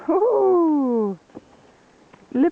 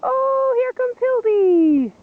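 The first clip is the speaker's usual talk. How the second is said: excited; 10 semitones higher, 3 dB louder, slower than usual. Real, not acted. Greeting dog arriving.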